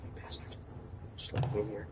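hushed whisper, barely voiced. Real, not acted. Player whispering to himself while sneaking in, hushed. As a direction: sneak in whispering so nothing hears.

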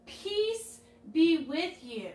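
put-on drawn-out words for kids to echo; slow call-and-response. Shown as a demonstration. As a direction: say it slowly so they can follow. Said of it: "Let's say it one more time".

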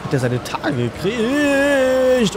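high, slow mock voice. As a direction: stretch it out in a high sneering voice. Mocking the gun dealer.

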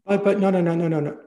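high, loud rush of no's; emphatic protest. Real, not acted. Reassuring the interviewer it was no slight, loud and eager. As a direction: wave off the apology eagerly. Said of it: "reassuring interviewer it wasn't a slight".

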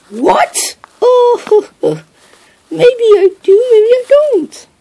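very high, coy and teasing; flustered giggly high voice. Acted. Matilda asked to kiss Bomb.

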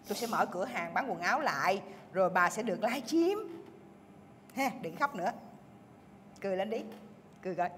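soft, quiet reassurance; gentle coaxing. Acted. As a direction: coax a smile out of her. Dỗ dành bạn buồn. (dỗ dành khẽ khàng)

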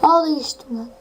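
ordinary talk, nothing marked

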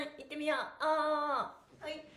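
ordinary talk, nothing marked

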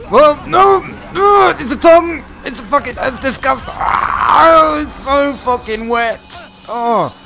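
high, loud shrieks of disgust; frantic, grossed-out yelling at full pitch; panicked screaming. Real, not acted. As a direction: scream in revulsion and alarm. Attacked by tongue in game.

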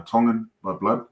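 normal talking voice

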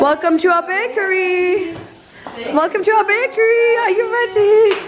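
high-pitched, playful welcome; mock bakery host. Vlogger welcoming guests to bakery, high playful host voice.

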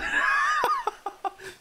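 sudden high-pitched laugh. Acted. Laughs after story.

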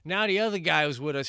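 usual speaking voice

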